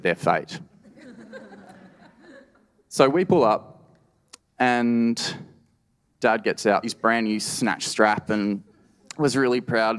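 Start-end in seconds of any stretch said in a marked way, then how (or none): none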